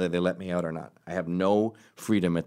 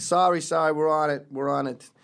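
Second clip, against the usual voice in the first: higher-pitched, placating mock apology; hurried apology; 7 semitones higher than usual. Detectives answering the angry captain, mock hurried apology. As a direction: imitate underlings scrambling to appease the boss.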